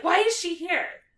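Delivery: high, loud indignant whine; mock offended squeal; indignant Lavender. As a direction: demand it like a jealous girlfriend. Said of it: "she's like".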